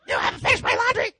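very loud put-on bellow leaping to a screech; mock yelling at mom. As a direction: holler like an overgrown child demanding laundry. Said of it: "I see them yell at their mom".

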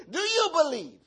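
high, loud demanding question; shouted challenge. Acted. Preacher challenges the congregation on their belief, shouting. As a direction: fire the question out at the top of your voice.

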